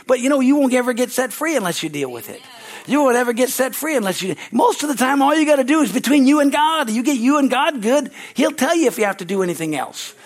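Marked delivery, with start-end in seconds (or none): 0.1-8.7 rising, loud, high preaching; preaching at full force. Real, not acted. Preacher urging the congregation, loud and high. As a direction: press the point hard at the crowd.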